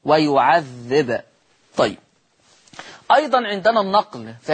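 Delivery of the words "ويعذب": ويعذب slow with the ب bouncing at the end; echoed ba. Shown as a demonstration. Teacher demonstrating qalqala on a silent ba. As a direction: give ويعذب a ringing qalqala ba. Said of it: "لما الباء تسكن هتبقى مقلقلة".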